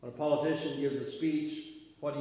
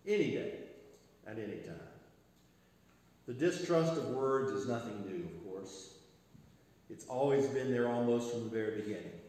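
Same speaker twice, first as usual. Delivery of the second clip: normal talking voice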